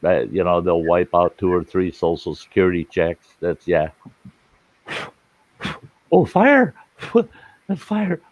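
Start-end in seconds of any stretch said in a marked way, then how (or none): 6.1-8.2 sudden high cry of fire; alarmed. Real, not acted. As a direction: yelp it, startled and laughing. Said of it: "It's out".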